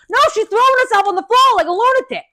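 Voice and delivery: fast, high, loud correction; incredulous outburst. Retold or imitated. Daughter corrects her mom about the woman, incredulous outburst.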